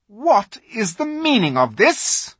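high, loud cold queen demanding an answer; icy royal challenge; furious witch queen. A narrator's reading. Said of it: "asked the witch queen".

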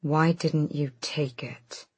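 slow hollow whisper; hollow and hard. Acted. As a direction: breathe it out hard and empty. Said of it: "The voice was hollow and hard".

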